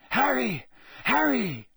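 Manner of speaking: high, loud urgent call of a name. Acted. Headmaster calling the stunned boy's name, high and urgent. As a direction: call out to rouse him.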